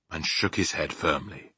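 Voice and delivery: ordinary delivery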